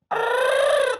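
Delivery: high screeching creature roar; dinosaur roar. Shown as a demonstration. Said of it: "you sound like a dinosaur".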